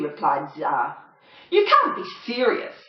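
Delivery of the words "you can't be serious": a lightly voiced mocking dingo, higher; sniggering dingo. Saxby mocking the small poodle, light snigger. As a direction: sneer at the little poodle. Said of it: "sniggered Saxby".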